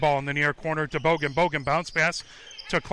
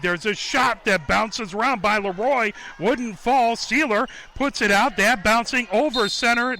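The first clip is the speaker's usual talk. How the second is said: raised, louder call of live action; excited play-by-play; 6 semitones higher, 5 dB louder than usual. Real, not acted. Commentator follows a shot bouncing around, excited. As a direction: follow the loose ball with rising urgency.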